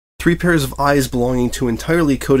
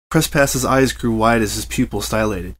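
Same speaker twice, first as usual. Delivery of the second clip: ordinary delivery, nothing marked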